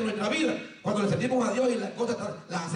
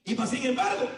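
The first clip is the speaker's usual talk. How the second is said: ordinary delivery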